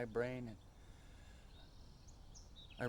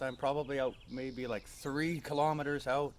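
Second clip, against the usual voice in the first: normal talking voice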